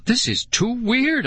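high, slow, disbelieving cry; freaked-out exclamation, pitch way up; alarmed cry. A narrator's reading. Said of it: "I cried".

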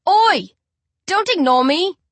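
high, loud and indignant; angry shout. Acted. Narrator yells at Tyler for ignoring him, angry shout. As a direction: bark at him for ignoring you. Bully confronts ignoring boy.

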